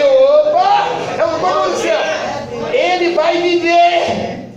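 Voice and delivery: high, drawn-out triumphant cry; full-force preaching. Real, not acted. Preacher declares the boy will live, full-force preaching. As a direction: cry it out slowly in faith.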